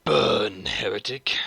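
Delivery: rasped loud battle cry; space marine voice. Shown as a demonstration. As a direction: snarl it at the heretic. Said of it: "voice sound like a space marine".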